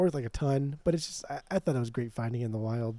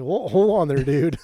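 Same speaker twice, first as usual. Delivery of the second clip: mock alarm; 9 dB louder than usual. Stopping customer, alarmed.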